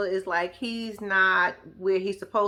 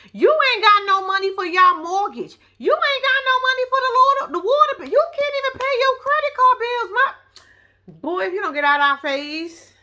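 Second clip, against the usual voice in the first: much higher, louder scolding tirade; angry rant; 10 semitones higher, 6 dB louder than usual. Real, not acted. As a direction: let it rip at him. Ranting at Chance about money.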